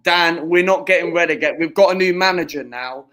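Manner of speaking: loud, forceful rebuttal; emphatic insistence. Real, not acted.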